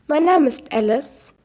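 lightly voiced AI woman with stilted stress; a hint of a computer lady, odd emphasis; mild synthetic female assistant voice; unnatural woman's voice. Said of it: "erwidert die Stimme".

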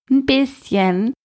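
high, slow mocking echo; mocking imitation. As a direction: ape him, sneering. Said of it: "äffte mich ein Junge mit Zahnlücke nach".

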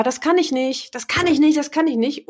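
a hint of a self-doubting student; whiny participant. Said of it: "die sagt immer".

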